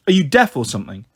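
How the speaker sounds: ordinary delivery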